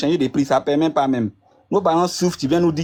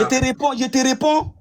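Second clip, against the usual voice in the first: high-pitched, urgent cutting in; really trying to get a word in; insistent interjection; 10 semitones higher than usual. Real, not acted. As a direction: push in over the other speaker, voice raised high.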